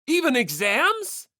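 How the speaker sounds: can't believe it; sharp surprised question; incredulous. Acted. Classmate reacts in disbelief.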